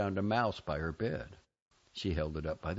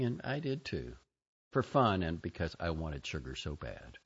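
ordinary delivery, nothing marked

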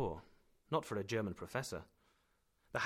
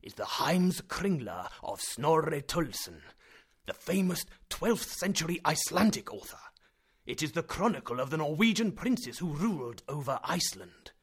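loud, higher, swelling with pride; excited professor; 5 semitones higher, 8 dB louder than usual. A narrator's reading. Professor boasting to his nephew, loud and excited. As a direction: lecture it like a thrilled scholar. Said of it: "getting excited".

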